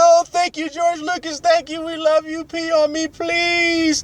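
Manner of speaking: very high, fawning fan voice; gushing fans. Retold or imitated. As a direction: grovel in mock adoration. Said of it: "And everybody's like".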